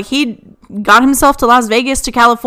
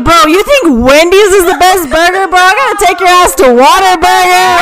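higher, incredulous ribbing; playful disbelief, building louder; mock outrage; 6 semitones higher, 4 dB louder than usual. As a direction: challenge him like a scandalized buddy. Mock outrage at cousin's burger praise, retold.

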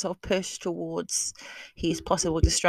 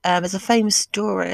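normal talking voice

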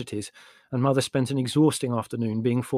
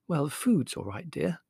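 noncommittal; 4 semitones higher, 4 dB quieter than usual. A narrator's reading. Mother refusing to commit herself.

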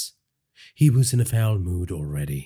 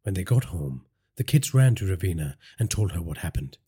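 ordinary delivery

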